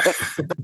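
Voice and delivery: high little laugh. Real, not acted. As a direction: chuckle at your own joke. Laughs at own joke.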